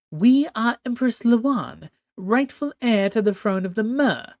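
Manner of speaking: high regal voice, commanding and cold; imperious empress. Acted. Empress Lawan overruling her nervous captain, haughty high command. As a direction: speak as a haughty sea empress giving orders.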